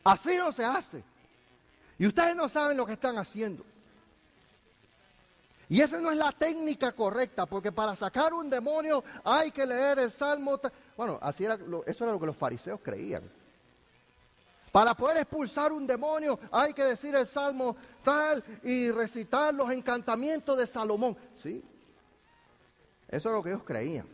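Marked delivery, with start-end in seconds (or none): 0.0-10.7 higher, louder scolding; arguing Pharisee. Retold or imitated. Fariseos discuten con los discípulos. 14.7-21.1 higher, louder scolding; arguing Pharisee. Retold or imitated. Fariseos discuten con los discípulos.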